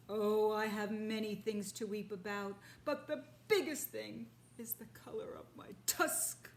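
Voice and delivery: a vain beast grieving its tusk; mournful rhinoceros. Acted. Rhinoceros lamenting to the father, mournful.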